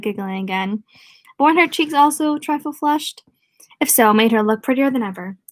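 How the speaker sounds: ordinary delivery, nothing marked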